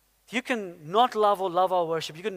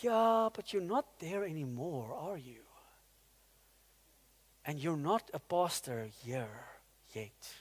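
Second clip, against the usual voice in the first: soft, lowered reply with pauses; retold gentle truth; 4 semitones lower, 9 dB quieter than usual. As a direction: quietly put a proud friend in his place. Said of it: "he shared the truth".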